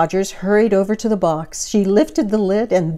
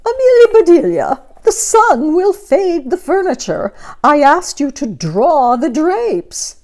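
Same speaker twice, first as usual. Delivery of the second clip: dismayed cry; 10 semitones higher, 7 dB louder than usual. Acted. Missus Rogers scolding Amelia about fading furniture, high loud cry. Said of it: "said Missus Rogers".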